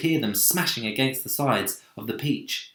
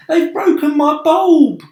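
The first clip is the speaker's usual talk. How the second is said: high loud wailing; 14 dB louder than usual. Acted. Glowworm lamenting her broken bulb, high wail. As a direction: wail it miserably.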